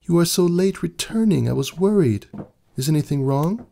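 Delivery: fretting over his late return; worried wife. A narrator's reading.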